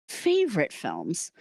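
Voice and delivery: ordinary talk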